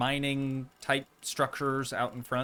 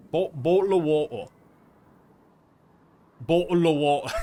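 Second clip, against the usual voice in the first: "bottle of water" shouted, glottal British t's; loud mock British; 8 dB louder than usual. Shown as a demonstration. Streamer hamming up British speech for chat, loud mock accent. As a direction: bark "Bottle of water" like a cartoon Brit. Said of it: "We say".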